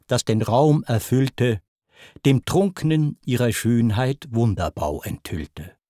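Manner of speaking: ordinary delivery, nothing marked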